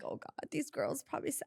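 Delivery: whispered worry; panicked whisper. Retold or imitated. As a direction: whisper it like you're doomed. Fearing she was fired.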